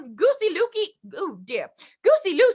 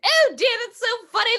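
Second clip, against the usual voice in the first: usual speaking voice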